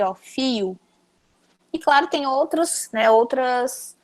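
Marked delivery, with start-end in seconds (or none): 0.1-0.8 filho dito fio, LH como I; caipira accent. Shown as a demonstration. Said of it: "o som do L H fica o som de I". (filho no sotaque caipira, fio)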